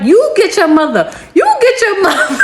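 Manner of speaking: high, playful retold back talk; mock sassy comeback. Siblings joking back at mom, mock sassy. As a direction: throw it back cheekily, pitch climbing.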